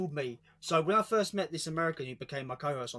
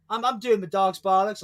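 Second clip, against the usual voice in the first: a louder American trying British slang; mock American saying bollocks; 4 semitones higher, 8 dB louder than usual. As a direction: Yank mangling a British phrase, pushed out loud. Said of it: "That doesn't sound right".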